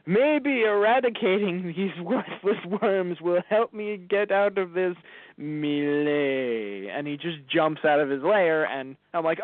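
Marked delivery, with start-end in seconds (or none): 0.0-6.9 lightly voiced villain Aku, slow and scheming; a hint of the demon Aku musing aloud; voicing Aku. Retelling the episode to a friend, lightly voicing Aku slowly. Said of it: "just like Aku going".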